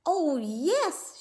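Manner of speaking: high, quick yes; eager princess. A narrator's reading. Princess agreeing to the frog at once, high eager. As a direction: jump at the deal. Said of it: "she answered".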